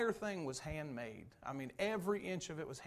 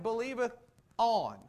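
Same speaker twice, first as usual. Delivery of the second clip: "Believeth on" with "on" held and louder; stress on "on"; 6 semitones higher, 7 dB louder than usual. Shown as a demonstration. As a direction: lean hard on "on" after "Believeth". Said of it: "There is a difference between believing in and believing on".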